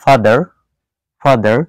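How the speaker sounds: American "ফাদার" with final r sounded; American rhotic "father"; American accent. Shown as a demonstration. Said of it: "American অ্যাকসেন্টে বলতে চাই".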